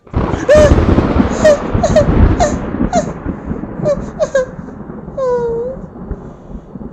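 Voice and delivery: long high crying wail, loud; loud high crying sob; baby wail. Acted. Baby wails at the storm.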